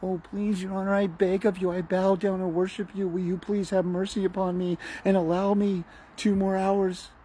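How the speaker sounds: high, whimpering beggar before the judge; mock groveling plea. Mocking a father begging the judge, pleading pathetically. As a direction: grovel and plead like a cowering servant. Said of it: "you crawl in there".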